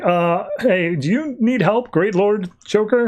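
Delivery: high-pitched, tentative question; hesitant offer. Retold or imitated. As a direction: act out timid followers checking on their lord. Villagers timidly offer their lord help.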